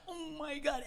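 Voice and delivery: tiny high whimper, very soft; mock desperation. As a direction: squeak it out, acting desperate. Desperate wait in bathroom story.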